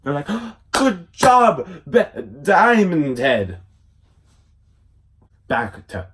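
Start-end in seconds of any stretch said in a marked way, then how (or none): 0.7-3.6 higher, louder, slow cheer; mock cheer. Cousins cheering Diamond Head, excited. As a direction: stretch out the praise.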